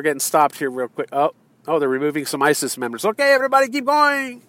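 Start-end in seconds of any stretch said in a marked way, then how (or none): 3.0-4.4 high, calling out to the crowd; mock caravan leader. Caravan leader calls the marchers onward, high mock call. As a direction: wave the crowd on.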